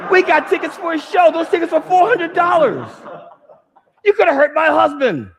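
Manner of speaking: screaming high and loud at the other driver; furious yelling. Retold or imitated. Wife screaming at the driver who hit them, furious. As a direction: yell it, pitch way up, full fury.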